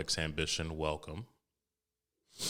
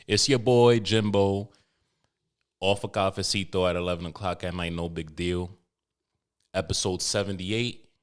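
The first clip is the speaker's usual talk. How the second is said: ordinary talk, nothing marked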